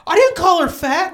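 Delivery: high, loud laughing protest; defensive mom. Retold or imitated. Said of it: "And she's like".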